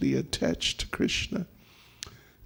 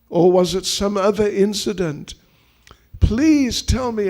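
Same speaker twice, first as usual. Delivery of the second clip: higher, louder acted pleading; anxious pleading; 4 semitones higher, 5 dB louder than usual. Husband asking his crying wife what he did, anxious pleading. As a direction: plead to know your fault.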